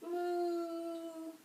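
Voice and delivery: soft lowing moo; gentle moo for the kids; quiet playful cow sound. Shown as a demonstration. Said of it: "and then a cow".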